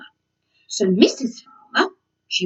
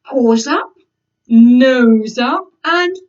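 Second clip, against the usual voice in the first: loud snooty; 2 semitones higher, 7 dB louder, slower than usual. Acted. Acting spoilt cat pose.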